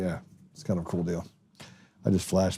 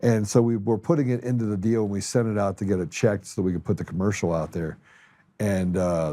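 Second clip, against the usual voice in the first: normal talking voice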